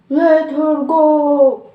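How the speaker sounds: loud, slow roared order; commanding villain roar; Captain Hook. A narrator's reading. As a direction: roar it out, drawing out each word. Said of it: "Peter roared, still sound like Captain Hook".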